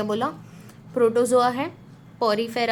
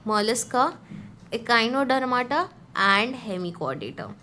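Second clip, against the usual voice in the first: slow recital; much slower than usual. Shown as a demonstration. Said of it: "reciting phylum names".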